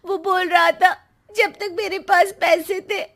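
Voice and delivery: high, loud tearful wailing; crying. Acted. Abandoned daughter cries to her parents, begging forgiveness. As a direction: sob out the apology, voice breaking. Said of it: "आ जाती है रोते हुए".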